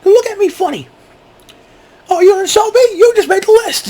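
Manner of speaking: very high cartoon voice; silly list keeper. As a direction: squeak out the threat in falsetto. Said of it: "Bring a little pad and paper".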